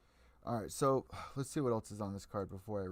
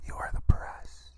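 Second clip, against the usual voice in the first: slow, louder voiceless whisper stressing the; loud stage whisper; 7 dB louder than usual. Acted. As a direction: hammer the point home in a harsh whisper. Repeating the point to media.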